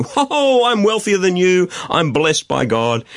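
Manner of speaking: high-pitched, drawn-out mock gloating; boastful wealthy people. Preacher mimicking wealthy people who flaunt their blessing, boastful. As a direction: crow it like a show-off, starting high. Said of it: "wealthy people running around saying".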